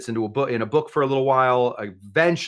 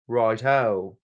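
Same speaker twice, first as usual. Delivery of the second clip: put-on English accent. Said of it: "It's a good English accent".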